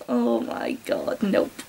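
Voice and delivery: breathy, low whisper of horror; whispered disbelief. Real, not acted. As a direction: whisper it to yourself, appalled. Reader reacts in disbelief.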